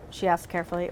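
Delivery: ordinary delivery, nothing marked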